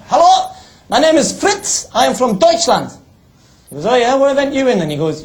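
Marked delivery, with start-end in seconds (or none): 0.1-2.9 much higher, louder mock German accent. German lad bluffing the guard, loud high mock German accent. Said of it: "the German lad says". 3.9-4.8 louder, higher Geordie guard; a mock Geordie security man, loud and casual; Geordie accent. Newcastle guard asking the fake athlete, loud mock Geordie accent. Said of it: "the security were from Newcastle as well".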